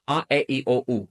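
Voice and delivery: A, e, i, o, u as ah, eh, ee, oh, oo; "A, e, i, o, u" as five evenly spaced Spanish vowels. Shown as a demonstration. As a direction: run through "A, e, i, o, u" with pure Spanish sounds. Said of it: "Our five vowels in Spanish are".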